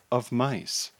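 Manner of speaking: ordinary delivery, nothing marked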